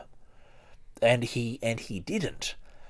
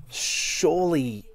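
sarcastic drawl; 8 semitones higher, 4 dB louder, much slower than usual. Acted. Wry comment on failed prophecy.

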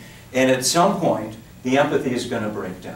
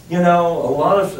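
ordinary talk, nothing marked

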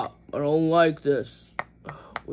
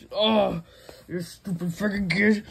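ordinary talk

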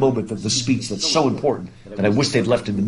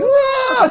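a sudden, much higher, louder mock "Ah!"; imitating son's mock scream; 4 dB louder than usual. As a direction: ham up the kid's fake dying scream. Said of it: "He's like".

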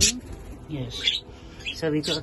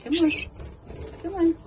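high coaxing call; coaxing bird; 11 semitones higher, 4 dB louder, faster than usual. Real, not acted. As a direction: sing it up high to lure the bird in.

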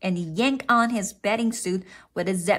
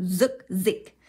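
ordinary delivery, nothing marked

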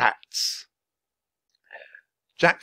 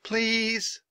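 high, drawn-out pleading; impatient plea; 12 semitones higher than usual. A narrator's reading. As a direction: beg in a high girlish voice. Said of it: "interrupted Annie".

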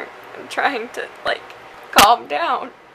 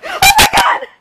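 panicked scream; 13 semitones higher, 15 dB louder than usual. Real, not acted. Sees huge moth on camera.

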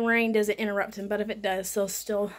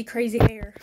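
ordinary talk, nothing marked

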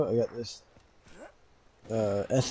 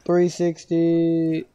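slow, drawn-out, higher and louder call; calling out the trick; 5 semitones higher, 5 dB louder, much slower than usual. Real, not acted. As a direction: stretch the words out, raised pitch.

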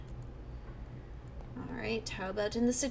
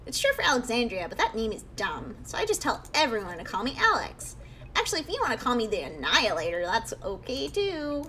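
put-on little kid voice. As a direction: play a cheeky ghost kid pretending to be normal. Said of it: "I'll just give it a little kid voice".

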